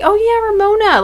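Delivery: high-pitched recalled reaction; re-enacted realization. Viewer recalling how she felt seeing Ramona, high mock realization. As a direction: replay the thought way up high. Retelling own reaction to trip.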